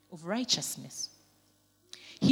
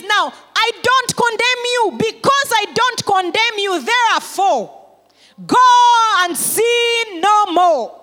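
much higher, louder and slow, drawn out; proclaiming Jesus' words; 13 semitones higher, 14 dB louder, slower than usual. Acted. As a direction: proclaim each phrase like a verdict of grace. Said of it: "He says".

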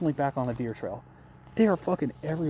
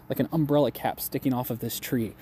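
ordinary delivery

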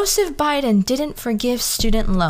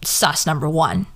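ordinary delivery

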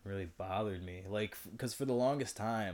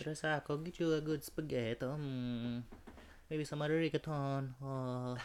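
higher, sing-song put-on accent; hammy food pusher; 6 semitones higher than usual. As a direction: tempt him with food in a caricature voice. Mock Italian food-pushing character.

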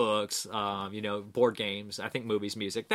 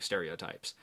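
ordinary delivery

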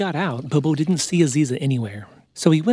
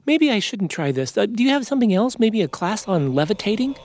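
high, hesitant character voice; higher-pitched, hopeful storybook bear; doubtful bear; 8 semitones higher than usual. Acted. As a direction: back out awkwardly and ask for another option. Bobo voiced by narrator.

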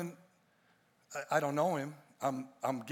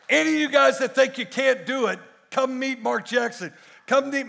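loud, raised, driving sermon delivery; full-force preaching; 8 semitones higher, 13 dB louder than usual. Real, not acted. Preacher urging the congregation to come back and be pruned, full force. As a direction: preach it hard to the whole room.

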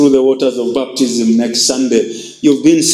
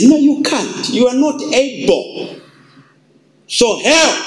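Jesus refusing; 8 semitones higher than usual. Acted.

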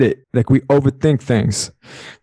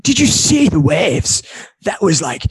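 awestruck mock California accent; 4 semitones higher than usual. Shown as a demonstration. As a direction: drag it out like a Californian surfer. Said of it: "what's a California accent?".

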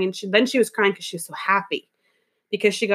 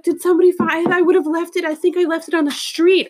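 higher, rushed frazzled voice; flustered woman; 7 semitones higher, 3 dB louder than usual. Retold or imitated. Woman explaining to the stylist, higher frazzled. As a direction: keep her voice up and flustered. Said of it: "And she was like".

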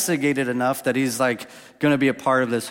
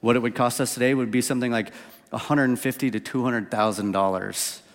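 ordinary talk

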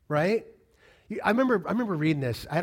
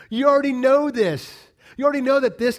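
louder, higher, pressing the point; emphatic preaching shout; 6 semitones higher, 9 dB louder than usual. Real, not acted. Preacher pressing the church on what they know. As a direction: hammer home you already know this.

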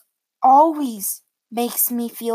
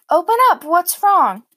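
high, loud worried call; calling through a door; 7 semitones higher, 8 dB louder than usual. Retold or imitated. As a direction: call out to your child behind the door. Mom calling through bedroom door.

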